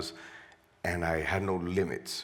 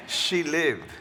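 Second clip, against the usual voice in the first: higher, louder, said through a laugh; a real burst of laughter around the words; 10 semitones higher, 4 dB louder than usual. Real, not acted. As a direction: laugh out the answer in disbelief. Repeats the answer with a laugh.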